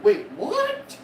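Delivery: a high, surprised double take; startled teachers. Retold or imitated. Teachers reacting.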